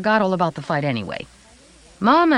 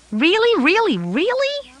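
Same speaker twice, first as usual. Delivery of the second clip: put-on high, rising childlike delight; excited little boy; 3 dB louder than usual. A narrator's reading. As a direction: squeal it like an excited kid.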